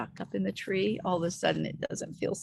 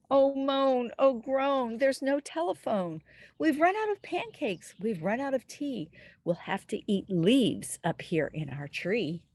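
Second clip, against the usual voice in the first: put-on reading to children; 5 semitones higher than usual. Stranded tree dwellers wailing to children listening, mock dismay. Stuck characters lament.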